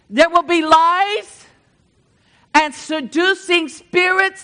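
urgent warning from the pulpit; forceful preaching. Acted. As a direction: preach it out hard to the congregation.

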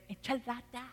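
light mock Spanish twang, softly said. Preacher mimicking Brother Roman's lunch answer, Spanish twang. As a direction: imitate a soft Spanish twang. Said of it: "little Spanish twang".

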